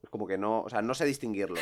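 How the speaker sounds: ordinary talk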